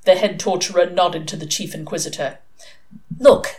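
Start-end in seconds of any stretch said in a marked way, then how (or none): 3.2-3.6 higher, louder pleading; desperate. A narrator's reading. Pepper reasoning with her stubborn little sister, desperate. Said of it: "said Pepper desperately".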